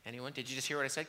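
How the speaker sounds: ordinary talk, nothing marked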